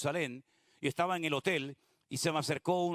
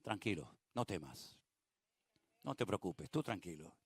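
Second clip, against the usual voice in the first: soft, low soothing voice; calm Jairo down tenderly; Jesus softly comforting the frightened father; gentle reassurance; 8 semitones lower, 8 dB quieter than usual. Acted. The Lord speaking to listeners.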